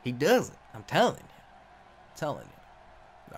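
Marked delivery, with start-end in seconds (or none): 1.2-3.3 low, slow unvoiced whisper; deep whisper. Shown as a demonstration. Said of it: "repeated in deep whisper".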